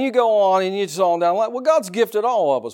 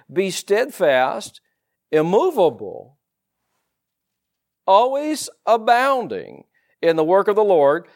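scripture reading; 3 semitones higher than usual. Acted. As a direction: lean on each word of the verse.

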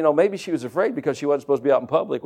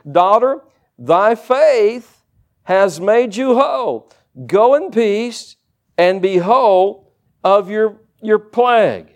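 slow, emphatic, raised preacher's reading; scripture reading; 4 semitones higher, 3 dB louder, much slower than usual. Acted. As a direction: proclaim each phrase of the verse with weight. Reads Jesus' words from scripture aloud.

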